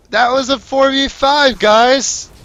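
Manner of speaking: high, loud, slow outburst, weight on "five"; incredulous shout. Real, not acted. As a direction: yell "four v five" leaning on "five". Caster ranting at losing team.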